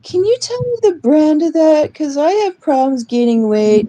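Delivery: put-on high voice, mocking a friend; Kira. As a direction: mimic a self-centred acquaintance butting in. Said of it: "Kira makes it all about herself".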